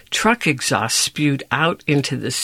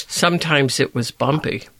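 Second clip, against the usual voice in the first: usual speaking voice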